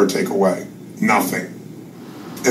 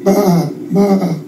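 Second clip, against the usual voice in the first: sheep bleat; 8 semitones higher than usual. Acted. Sheep bleat in lion story.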